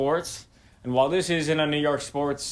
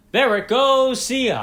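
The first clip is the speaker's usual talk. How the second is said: Michael Kay call; 11 semitones higher, 6 dB louder than usual. Retold or imitated. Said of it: "Taken from the legendary Michael K phrase".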